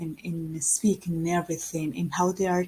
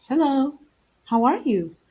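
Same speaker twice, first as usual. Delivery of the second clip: higher, livelier hello; animated greeting with pitch jumping up; expressive greeting; 5 semitones higher, 5 dB louder than usual. Shown as a demonstration. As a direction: greet brightly with a swooping tune. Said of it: "You say, like".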